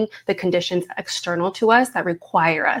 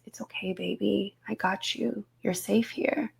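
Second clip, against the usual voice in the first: put-on soft, much softer whisper, soothing a scared child; tender whisper; 11 dB quieter than usual. Shown as a demonstration. Mother soothing her four-year-old daughter after a nightmare, whispering. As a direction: hush and reassure a frightened little one. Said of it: "my voice is gonna, gonna drop into this".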